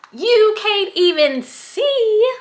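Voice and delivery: high, slow Southern twang on every word; southern accent. Shown as a demonstration. Vlogger complaining about tiny scrunchies, in a Southern accent. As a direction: let a Southern accent slip out, drawn out. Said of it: "my southern accent is coming out now".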